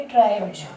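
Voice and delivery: "ट्राइब्स" ending in a voiced j; final J sound. Shown as a demonstration. Teacher showing the word to students, final J sound. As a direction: say "ट्राइब्स" as traib-j. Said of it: "तो एस का उच्चारण क्या होगा इसमें".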